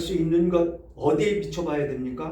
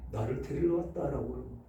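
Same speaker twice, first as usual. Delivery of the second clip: ordinary talk, nothing marked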